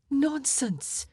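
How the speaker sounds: high, emphatic exclamation; high-pitched reassuring protest; exclaimed dismissal. Acted. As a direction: dismiss the fear at once. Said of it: "Margaret exclaimed".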